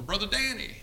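high-pitched mock call-out; retold holler. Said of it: "And I hollered over".

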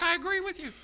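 high-pitched acted protest; exasperated agreement. Acting his exasperated agreement.

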